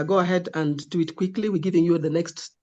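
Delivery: ordinary talk, nothing marked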